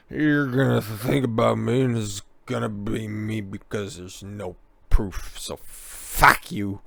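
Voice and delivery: put-on slurred drunk English accent; slurring drunk. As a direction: drag it out, drunk and defiant. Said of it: "before slurring".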